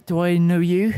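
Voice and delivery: lightly voiced nervous Kiwi young man; a hint of a New Zealand accent, unsure. GM as Gavin meeting strangers, in a light Kiwi accent. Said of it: "that accent has gone".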